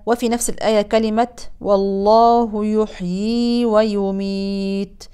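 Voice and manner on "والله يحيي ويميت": يحيي with the second ya drawn two counts; two-count madd. Shown as a demonstration. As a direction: hold the ya of يحيي briefly. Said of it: "ياء مادية بمقدار حركتين". (مد حركتين)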